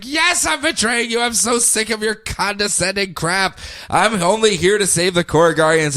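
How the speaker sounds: high-pitched acted indignation; mock rage. Rebel answering Sinestro's accusation, mock rage. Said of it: "she goes full on rage mode".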